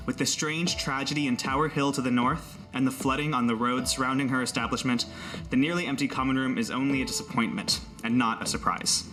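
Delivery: ordinary delivery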